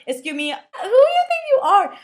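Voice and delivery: high, loud, affronted confrontation; sassy, over-the-top disbelief; mock indignation. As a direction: put an uppity kid in their place, hammed up. Imagines confronting the book's kids.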